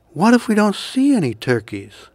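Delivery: a hint of an unsure younger brother; little pilgrim. A narrator's reading. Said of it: "The little pilgrim".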